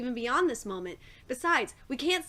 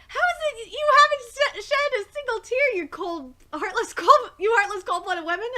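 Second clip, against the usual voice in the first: higher and louder, accusing; indignant scolding; 7 semitones higher, 6 dB louder than usual. Acted. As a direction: scold them for not crying. Character scolds dry-eyed companions.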